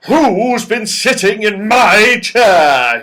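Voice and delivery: a big bear growling over his chair; angry father bear. A narrator's reading. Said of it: "the father bear gave an angry growl".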